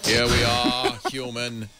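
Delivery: menacing robot invader voice, slow; booming arrogant alien overlord. Pretend. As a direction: gloat like a conquering alien overlord. Robot army marching toward viewers.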